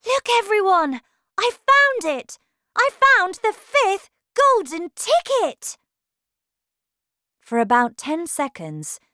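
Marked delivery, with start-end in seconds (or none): 0.1-5.8 high, loud cry of discovery; excited shout. A narrator's reading. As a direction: shout the big news at the top of your voice. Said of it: "he shouted".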